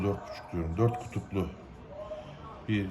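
ordinary talk

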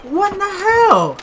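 stunned what the hell; shocked. Real, not acted. Sudden shock in firefight.

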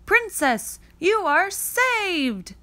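high, slow rescuing cry; mock heroic shout. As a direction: proclaim the rescue like a stage prince. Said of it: "he'd shout".

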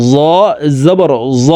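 জ said thick and full; heavy letter. Shown as a demonstration. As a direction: make জ heavy, unlike thin জাল. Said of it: "জ মোটা করে উচ্চারণ হবে".

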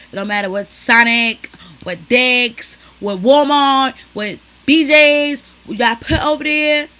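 loud, high rant; ranting shout. Real, not acted. Ranting to viewers about a trashy mall, shouting. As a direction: yell it in mock outrage.

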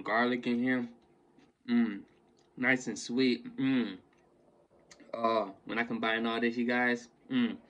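chewing while talking; savoring food mid-chew; mouth full. Real, not acted. Seen 2: Chewing face and moving jaw after forkful; corn-like food seen before.

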